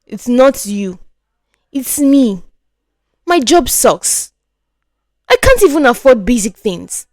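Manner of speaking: bitter, rising complaint in a man's voice; frustrated Richard. Acted. Richard to Ngozi, bitter and building. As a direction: let the shame build into anger.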